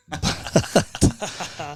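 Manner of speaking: high unvoiced chuckle; breathy laugh. Real, not acted. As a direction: laugh at your own joke. Laughs at his own joke.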